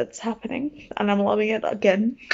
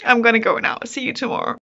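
usual speaking voice

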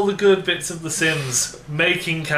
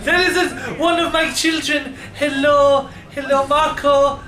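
put-on ambassador hailing child; 7 semitones higher than usual. Ambassador greeting a dragon as child, hammed-up accented calling. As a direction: call out fondly in a hammed-up foreign accent.

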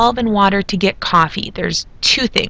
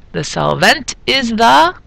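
slow, hammed-up Transylvanian accent landing loud on "water"; corny Transylvanian accent, W as V; bad Transylvanian accent; 4 semitones higher, 3 dB louder, slower than usual. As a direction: stretch it out in a hokey Transylvanian accent. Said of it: "I guess my bad accent's gonna be coming back".

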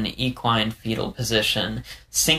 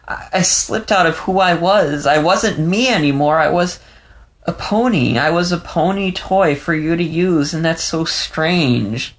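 put-on a young fox, voice pitched higher, on the edge of tears; Leo, shaken and tearful; 7 semitones higher than usual. A narrator's reading. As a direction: let Leo's words tremble, lost and confused. Leo's voice, confessing.

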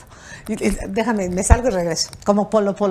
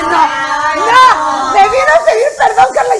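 high, loud mock protest; hammed-up exclamation of disbelief; outraged outburst; 8 semitones higher, 7 dB louder, slower than usual. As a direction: shout it in comic indignation. Indignant outburst about false apology.

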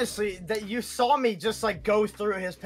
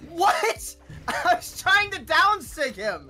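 indignant protest; 9 semitones higher, 3 dB louder, slower than usual. Real, not acted. Defends himself, voice shoots up.

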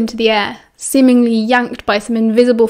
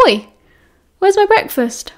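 high indignant outcry; indignant surprise; 9 semitones higher than usual. Acted. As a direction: protest with a high yelp. Sirius finds toast gone.

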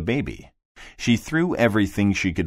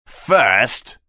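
high, raised character voice; fish voice; 4 semitones higher, 6 dB louder than usual. Acted. The fish speaks.

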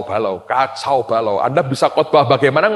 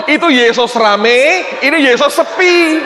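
much higher, louder, cheeky retort with a laugh; mocking security guard; 12 semitones higher, 5 dB louder than usual. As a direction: mimic the guard's cheeky excuse, high and loud. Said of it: "Dia ngomong". (menirukan satpam dengan suara tinggi dan keras sambil tertawa)

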